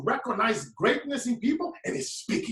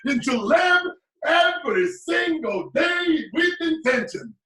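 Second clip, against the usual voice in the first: preaching shout; 9 semitones higher, 5 dB louder, slower than usual. Acted. Preaching climax.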